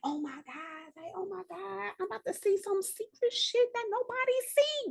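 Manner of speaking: soft, rising high-pitched awe; hushed excitement. Retold or imitated. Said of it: "the fucking excitement".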